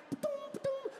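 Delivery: high shouted ba-doom chimes; rapid mock alerts. High rapid phone pings as kids run past. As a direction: fire off the pings in a panic.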